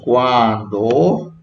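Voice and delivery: slow "cuando" swooping up from low; questioning. Shown as a demonstration. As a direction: demonstrate "cuando" as a long rising question. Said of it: "decimos cuando".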